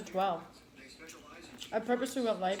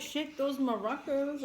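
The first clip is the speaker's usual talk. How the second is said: slow, higher-pitched catcall; catcalling construction worker; 6 semitones higher, slower than usual. Retold or imitated. Quoting construction worker catcalling her, drawn-out mock catcall. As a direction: draw it out like a hammy catcaller. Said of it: "or whatever he said to her".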